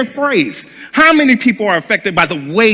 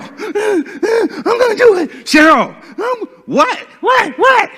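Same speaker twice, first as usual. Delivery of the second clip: much higher, slow threat then shrill shouts; high mock Cheryl voice; slower than usual. Cheryl threatening him, in a high mock voice then shouts.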